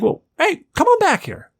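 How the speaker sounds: inviting singer. Retold or imitated. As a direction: beckon him backstage. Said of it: "he's like".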